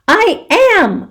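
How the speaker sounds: high, loud protest; crying out. Acted. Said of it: "I cry".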